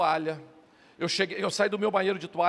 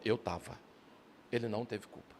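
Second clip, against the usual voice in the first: quiet firm answer; 8 semitones lower, 11 dB quieter than usual. Retold or imitated. Holy Spirit calmly answers.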